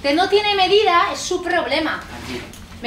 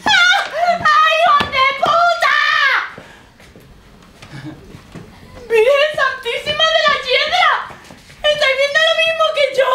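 high shrieking horror; horrified scream; 7 semitones higher than usual. Acted. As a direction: shriek at the ghost. Landlady horrified at the ghost.

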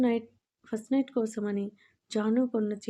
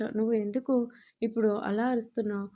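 plain reading voice

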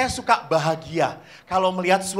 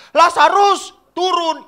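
much higher and louder shout; shout again; 8 semitones higher, 10 dB louder, slower than usual. Retold or imitated. Catechist as Jesus shouts Lazarus once more. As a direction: call him down hard. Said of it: "Yesus sekali lagi berteriak".

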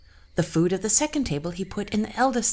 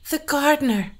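queen in pain; 9 semitones higher, 7 dB louder than usual. Acted. Queen forced to confess.

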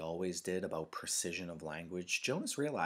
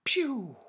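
high, loud pretend rifle shot; child's mouth-made gunshot; firing noise; 13 dB louder than usual. A narrator's reading. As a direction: shoot an imaginary rifle with your voice. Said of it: "with a firing noise".

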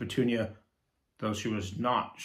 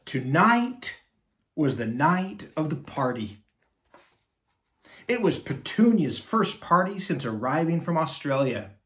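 higher, louder, lively read-aloud; storybook narration; 3 semitones higher, 5 dB louder than usual. Acted. Reading a picture book to children, lively narration. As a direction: tell it big for kids.

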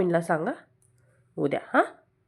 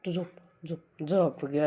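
normal talking voice

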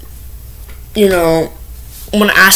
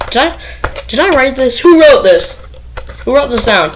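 higher, incredulous questions; surprised disbelief; 6 semitones higher than usual. Real, not acted. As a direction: sound thrown by what you just read. Finds odd note on list.

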